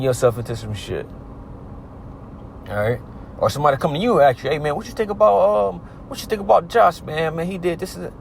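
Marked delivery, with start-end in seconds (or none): 4.5-8.1 high, excited gossip voice asking about Josh; nosy friend. Retold or imitated. A friend asks about Josh in a high gossip voice. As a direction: pitch it way up like a nosy buddy fishing for dirt.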